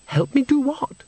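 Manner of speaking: very high, tinkling question; breathless tinkle. Acted. Agnes asks the voice in the mirror, breathless high tinkle. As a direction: pitch it way up, airy and wondering. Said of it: "that breathless tinkle in the tone".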